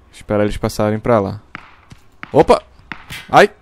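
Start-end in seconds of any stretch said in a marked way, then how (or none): 2.3-3.5 startled yelp. Real, not acted. Ambushed while looting.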